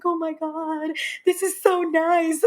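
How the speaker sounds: high, slow, delighted gush; happy sister. Retold or imitated. Sister's first Caribana, imitated in a high delighted voice. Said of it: "she was so happy".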